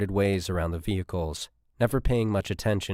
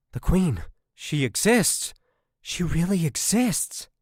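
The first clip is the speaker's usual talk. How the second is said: much higher, slowing on the last words; thrilled discovery; 10 semitones higher, slower than usual. Acted. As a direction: gasp it out as the find of a lifetime. Scientist sees the queen at last.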